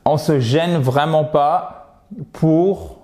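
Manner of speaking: ordinary talk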